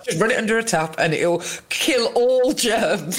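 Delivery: wry mock advice, pitched high. Host teasing the nan's cold-tap remedy, wry mock advice. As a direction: tease the cold-tap cure like it fixes everything.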